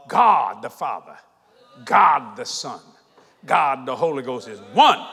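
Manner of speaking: low, hushed, measured phrases with long pauses; weighty, spaced-out declaration; solemn preaching. Real, not acted. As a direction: preach it low and deliberately, punching each God. Solemn, measured teaching of the Trinity.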